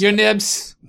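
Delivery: bow and say it grandly; posh servant voice. Acted. Innkeeper addressing guest.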